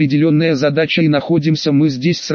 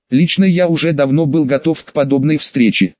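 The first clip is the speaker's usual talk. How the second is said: ordinary delivery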